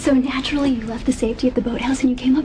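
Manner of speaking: fast whispered reproach; urgent hushed scold; hissed scolding. Acted. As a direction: scold under your breath. Scolding lover for risky move.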